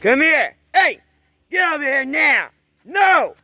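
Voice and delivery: loud sharp calls to dogs; yelling at dogs. Real, not acted. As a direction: shout the dogs back in.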